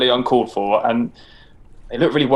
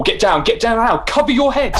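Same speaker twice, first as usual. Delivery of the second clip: urgent shout; 7 semitones higher than usual. Acted. Warning under spears.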